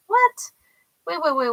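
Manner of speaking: high, loud jolt of disbelief; a real double take; startled what. Real, not acted. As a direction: stop dead, caught off guard. Sudden shock at a card.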